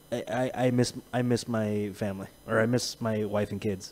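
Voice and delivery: soft, low and mournful, imitating him; quietly mope the words like a homesick man; mimicking sad voice. Friend imitating his pal missing his family, soft and mournful. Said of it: "you said".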